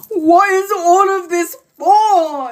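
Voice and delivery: high, slow, wailing mock sob; imitating crying mum. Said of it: "crying her eyes out".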